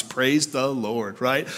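normal talking voice

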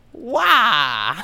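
loud, high cartoon cry of shock; shocked yell. Waluigi as Peach lands on his car, shocked shout. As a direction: yelp in exaggerated disbelief. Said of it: "who's completely shocked, goes".

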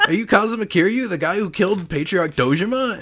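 high, laughing gasp of a startled guard. Acted. Funeral guard recognizing Kiryu, high startled laughing. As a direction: blurt it out in mock shock, cracking up. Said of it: "and then he's like".

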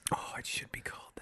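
soft, whispered approval; hushed delight. Real, not acted. Cohost loves naming the console after the agent, hushed delight. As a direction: whisper it, taken with the idea. Taken with the Nancy box idea.

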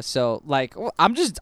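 usual speaking voice

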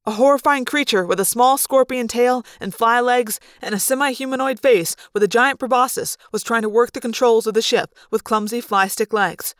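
ordinary delivery

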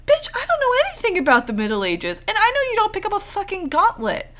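high, exasperated scolding rant; scolding yourself. Retold or imitated. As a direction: tell off the character in disbelief. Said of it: "I was like".

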